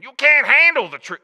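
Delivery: high, very loud hammed-up movie shout; Jack Nicholson. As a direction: go all out on a bad actor impression. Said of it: "Let's try that again".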